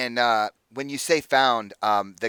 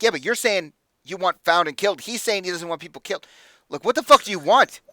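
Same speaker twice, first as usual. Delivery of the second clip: high, increasingly loud ranting; exasperated; 6 semitones higher, 6 dB louder than usual. Acted. Hothead confused by clients' demands, exasperated and building louder. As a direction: build from protest to an angry shout.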